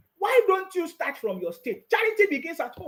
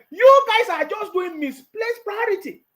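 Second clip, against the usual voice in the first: usual speaking voice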